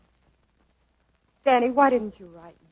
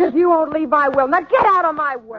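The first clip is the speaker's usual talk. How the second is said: high, loud, shoving past; angry outburst; 6 semitones higher, 7 dB louder than usual. Acted. Dancer storming past her partner, high and furious. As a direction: get out of my way, furious.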